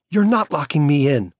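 a daughter refusing to be shut in; defiant little girl. A narrator's reading. Daughter voiced by narrator.

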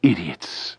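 bitter muttered insult. A narrator's reading. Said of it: "said Cassandra".

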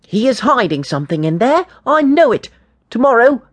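put-on high, emphatic boy's insistence; stern, insistent. A narrator's reading. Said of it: "said James sternly".

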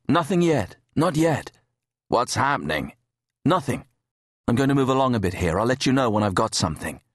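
a hint of the diver brushing off the question, speeding up; lightly voiced diver keeping it short; diver on comms. Diver Kate answers over comms.

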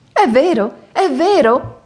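high, loud thrilled outburst; excited cry. A narrator's reading. Princess cries out to the court, thrilled and loud. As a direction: cry out in delight at the miracle. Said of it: "urlava ella".

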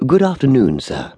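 high, courteous greeting dropping on "sir". A narrator's reading. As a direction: greet a valued customer with great courtesy. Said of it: "as courteously as to a millionaire".

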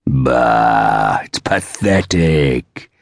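low, drawn-out scornful rumble; deep rumbling voice. A narrator's reading. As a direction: rumble it slowly, like a big older man. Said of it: "a deep voice rumbled from just behind them".

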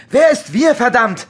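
shouting the question, stressing Wer; angry shout. Acted. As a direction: demand it again, furious. Repeats the question harder. (wütend gebrüllt)

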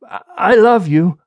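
halting, emotional declaration; choked up. A narrator's reading. Husband near tears telling his wife, choked up. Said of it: "able to talk again without falling apart".

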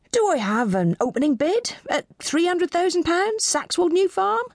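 high-pitched auctioneer calling for bids; fragile auctioneer. Acted. Auctioneer to the room, fragile authority. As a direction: open the bidding high, reaching for authority.

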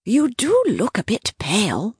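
put-on high-pitched elderly aunt; high aunt voice, mildly concerned; Dahlia, concerned. A narrator's reading. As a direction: remark on it in a high, fussy voice. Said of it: "Dahlia said".

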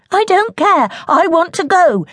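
put-on high, loud stubborn girl; defiant child.

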